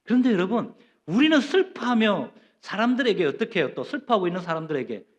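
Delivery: ordinary talk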